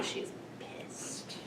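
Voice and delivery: hushed whisper to the audience; whispered aside. Real, not acted. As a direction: drop to a whisper, as if confiding. Confidential aside about the sister.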